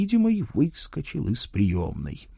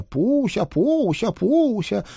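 shouting; 14 semitones higher, slower than usual. Acted. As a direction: yell it high and happy. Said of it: "крича".

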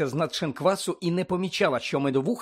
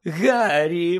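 louder call of the name; delighted exclamation; 3 semitones higher, 5 dB louder than usual. A narrator's reading. Reporter spots Harry in the pub, exclaiming. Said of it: "вигукнула вона".

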